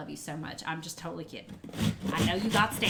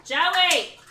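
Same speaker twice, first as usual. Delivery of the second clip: loud, high call across the house; calling out sharply; 7 semitones higher, 7 dB louder than usual. Real, not acted. Owner calling to a dog out of control, shouting. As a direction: holler the name at the dog.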